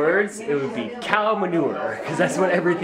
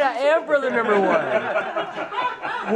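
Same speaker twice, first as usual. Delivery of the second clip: laughing, drawn out and proud; 10 semitones higher, much slower than usual. Real, not acted. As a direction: proudly declare it through a laugh.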